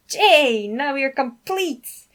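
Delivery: loud, slow, high cry of joy; delighted exclamation. Acted. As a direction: welcome the last arrival thrilled. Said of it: "Alexander exclaimed".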